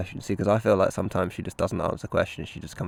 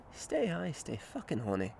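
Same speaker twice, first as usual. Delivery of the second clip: put-on Scottish accent; 6 semitones higher, 7 dB quieter than usual. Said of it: "I want the Scottish one to be good".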